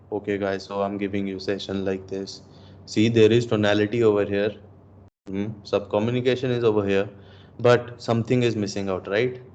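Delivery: low, subdued session talk without a smile; unsmiling delivery. Shown as a demonstration. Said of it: "The thing that's missing out is smile".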